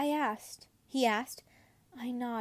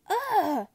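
loud high disgusted groan; whiny ugh; 8 semitones higher, 7 dB louder than usual. A narrator's reading. Septimus groaning with ice cream on his head. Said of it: "I whine".

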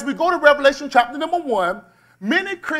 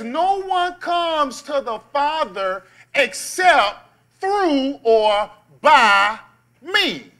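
slow solemn scripture; 3 semitones higher, much slower than usual. Real, not acted. Preacher slows scripture for emphasis.

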